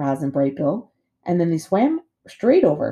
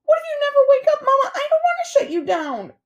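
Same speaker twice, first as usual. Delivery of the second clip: very high, panicky little gosling voice; squawking gosling; 4 dB louder than usual. A narrator's reading. Gosling afraid to shut his mama down, squawking. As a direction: squeal it in rising alarm. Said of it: "squawked Bright Bill".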